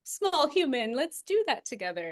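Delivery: high, loud, slowed and beaming; gushing welcome. Retold or imitated. Narrator imitating the hoped-for answer of the land, high and loud. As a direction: play the land eagerly welcoming her as a small human. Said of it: "I was really hoping for, like".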